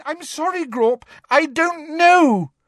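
very high pleading, rising to a loud wail; terrified squeal. A narrator's reading. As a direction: plead in terror with a giant. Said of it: "squealed Hermione, terrified".

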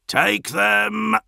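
high, loud battle bellow; bellowed order. A narrator's reading. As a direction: roar the charge at the squad. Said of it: "Drowsir bellowed".

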